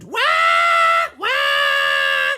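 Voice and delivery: loud screaming wails; monkey screams. A narrator's reading. Monkeys screaming their song for Lucy. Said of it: "The monkeys screamed".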